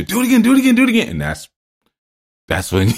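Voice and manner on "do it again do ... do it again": high, excited crowd chant; egging on. Retold or imitated. Onlookers egging the rider on, high excited chanting. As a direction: hype him up like a cheering crowd. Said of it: "'cause people gas you".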